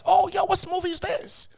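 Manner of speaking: high curious excitement; excited. Retold or imitated. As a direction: pitch it up like a kid spotting a film. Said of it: "I'm like".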